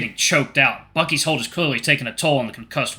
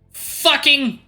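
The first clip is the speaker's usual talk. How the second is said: high choked cry through a shock; strangled cry; 14 semitones higher than usual. Acted. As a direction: force it out while convulsing. Wolf convulsing from shock.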